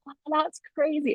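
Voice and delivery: high, hushed mock amazement; retold wonder. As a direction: act out marvelling at a cartoon. Retelling reaction to the Jetsons.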